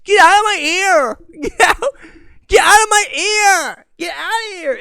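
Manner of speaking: high, loud tearful wail; near-tears yelling. Retold or imitated. Bullied teenager yelling at heckler in his ear, near tears. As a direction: wail it like a bullied kid about to cry. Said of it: "I'm about to start crying".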